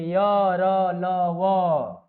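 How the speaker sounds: र ल व said very slowly, each letter stretched; slow listing. Shown as a demonstration. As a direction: stretch out र ल व for the class. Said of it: "teacher lists letters slowly".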